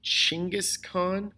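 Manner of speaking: Chinggis Khan, opening on a CH sound; careful CH at the start of "Chinggis Khan"; Chinggis pronunciation. Shown as a demonstration. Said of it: "might be closer to the actual pronunciation".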